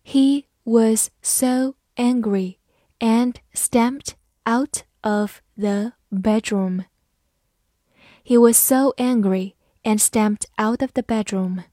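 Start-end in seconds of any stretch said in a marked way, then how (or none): 0.1-6.8 very slow, word-by-word model reading; teacher's slow read-along pace. Shown as a demonstration. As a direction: separate every word for learners to follow. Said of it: "慢慢来读".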